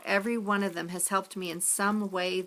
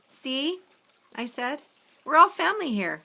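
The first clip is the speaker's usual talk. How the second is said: higher, louder glad declaration; joyful insistence; 7 semitones higher, 4 dB louder than usual. A narrator's reading. Narrator to Olive, a higher, louder joyful claim. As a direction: tell them all, delighted.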